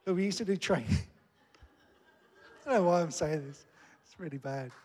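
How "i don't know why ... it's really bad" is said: embarrassed laughing. Real, not acted. Laughs at own awkward confession.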